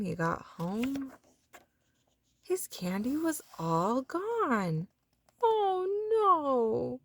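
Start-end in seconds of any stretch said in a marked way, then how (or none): 5.4-7.0 high wailing cry. A narrator's reading. Froggy finding his candy gone, high wail. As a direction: wail over the lost candy. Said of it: "cried Froggy".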